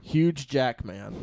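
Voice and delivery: "Huge Jackman" with Hugh said as "huge"; joke mispronunciation. Acted. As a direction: draw out "Huge Jackman" slowly, ending Hugh in a j sound. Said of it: "it's pronounced huge".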